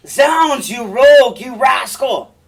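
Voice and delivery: high, loud shouted insults; furious stage shout, high-pitched; Cassio enraged. Acted. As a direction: roar it at an opponent in a sword fight. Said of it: "And Cassio".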